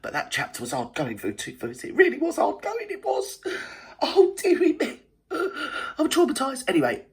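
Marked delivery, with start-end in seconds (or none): 1.8-6.6 mock traumatised. Mock dramatic reaction to book.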